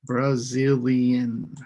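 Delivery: slow, Brazilian in four clear syllables; syllables split out. Shown as a demonstration. Teacher modelling Brazilian for students, slow and syllable by syllable. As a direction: draw out Brazilian beat by beat. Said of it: "four sounds".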